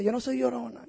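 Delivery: ordinary talk, nothing marked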